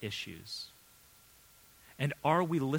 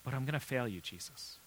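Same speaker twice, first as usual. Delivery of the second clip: grieved whisper; 2 semitones lower, 10 dB quieter than usual. Acted. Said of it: "And Peter is grieved".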